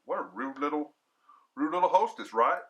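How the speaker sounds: plain reading voice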